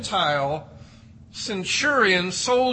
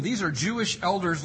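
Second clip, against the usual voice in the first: ordinary talk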